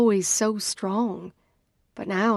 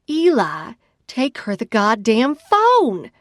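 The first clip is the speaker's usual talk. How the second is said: loud, drawn-out furious yell; shriek; 3 semitones higher, 7 dB louder than usual. A narrator's reading. Sister losing patience with the man, shrieking the order. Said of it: "I shriek into his ear".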